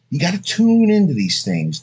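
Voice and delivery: ordinary talk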